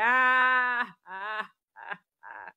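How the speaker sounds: drawn-out acted wail; mock crying. Showing how she cried during the hug, wailing. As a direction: let out a long pretend bawl. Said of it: "Umiyak na ako".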